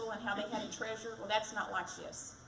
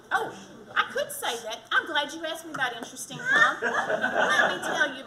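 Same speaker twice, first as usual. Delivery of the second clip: laughing; 7 semitones higher, 11 dB louder than usual. Real, not acted. Laughs after question asked.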